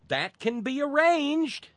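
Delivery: high, loud, slow sinister tone; menacing. Acted. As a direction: threaten it with a sinister smile. Menacing reply to gun owners.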